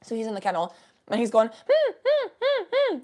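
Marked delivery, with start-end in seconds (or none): 1.5-3.0 high whining puppy hums; puppy whimpers. Retold or imitated. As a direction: whine like a dog stuck in a kennel. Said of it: "like, puppy noises".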